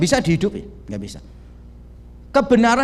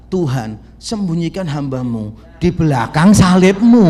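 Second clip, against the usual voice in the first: slow, loud mock prayer of a preacher; hammed-up pulpit prayer; preacher's prayer; 8 dB louder than usual. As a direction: pray it like a pastor hiding behind the cross. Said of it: "kebiasaan pendeta kalau khotbah".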